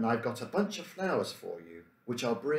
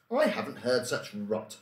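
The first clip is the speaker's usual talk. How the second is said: ordinary delivery, nothing marked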